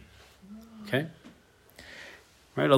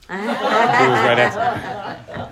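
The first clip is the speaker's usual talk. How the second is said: slow words squeezed out through laughter; stretched and laughing; 8 semitones higher, much slower than usual. Real, not acted. Punchline about life insurance.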